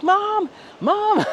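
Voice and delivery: high mock call for mom; kid calling mom. Scared boy on Millennium Force, high mock call for mom. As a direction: squeal for mom like a frightened kid.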